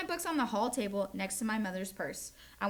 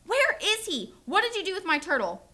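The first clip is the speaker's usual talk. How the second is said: much higher and louder, furious demand; yelling; 8 semitones higher, 6 dB louder than usual. Acted. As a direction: yell at the brother about the turtle. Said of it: "I yelled".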